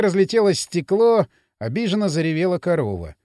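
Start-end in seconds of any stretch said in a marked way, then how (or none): none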